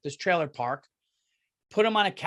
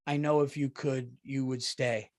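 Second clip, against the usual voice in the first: tender goodbye; 6 semitones lower, 5 dB quieter than usual. Retold or imitated. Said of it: "she hugs him and says".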